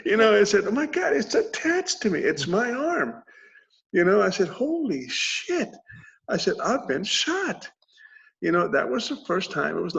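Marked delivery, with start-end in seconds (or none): 0.7-3.1 high-pitched exclamation of disbelief; mock alarm, high and incredulous; retold horrified shock. Retells horrified discovery of his arm. 4.5-5.7 high, long-drawn exclamation; acted disbelief, slow and high; retold stunned shock. Retells realizing he was shot. 6.7-7.6 high-pitched mock disbelief; acted amazement at the discovery; retold stunned realization. Retells realizing he was shot.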